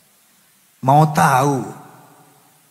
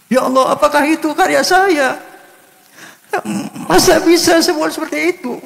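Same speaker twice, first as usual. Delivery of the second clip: high, retold amazement at his own song; a hint of teary wonder; tearful disbelief; 12 semitones higher than usual. Recalling hearing his song played, high astonished retelling. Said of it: "Saya menangis".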